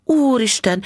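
high-pitched, astonished gasp of words; high "good heavens" of surprise; amazed exclamation. A narrator's reading. Wife shocked by the pawn value.

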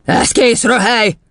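high, indignant dismissal; scornful snort. Acted. Headmistress ridiculing the rhyme, high scorn. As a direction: snort it out with contempt. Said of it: "horkantott Doron kisasszony".

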